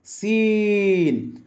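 সিন stretched very long, hissing s held; teacher modelling সিন for students; drawn-out letter demo. Shown as a demonstration. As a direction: hold the hiss of সিন slowly. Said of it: "লাগিয়ে উচ্চারিত হয়".

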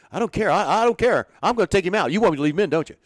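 high, loud agitated manager voice; high excited impression of an irritated manager; imitating Bobby Cox. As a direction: mimic the fired-up skipper snapping back.

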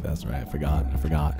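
ordinary delivery

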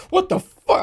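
high, loud startled shriek of fright; startled yelp. Real, not acted. Streamer spooked by a centipede, shrieking. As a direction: jump and yelp at a scary bug. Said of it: "I thought this was a giant centipede".

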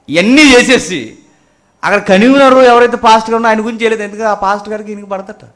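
high, loud burst, then racing; full-force preaching. Acted. Preacher exposing a convener's selective prayer, high rushed preaching.

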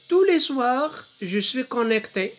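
slow verse, high loud opening; poem recitation. Shown as a demonstration. Teacher reads the poem to pupils, declaimed slow recitation. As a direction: launch the line high, then draw it out. Reading poem aloud in lesson.

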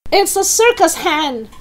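high rooftop holler; shouting. Acted. Grandma watches the hen somersault, shouting high. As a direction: call out amazed, pitched high. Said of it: "Grandma shouted from the rooftop".

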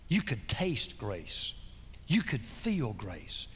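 soft, slow, reverent sermon voice; hushed preaching. Acted. Preacher softly describing Jesus's grace, hushed and slow.

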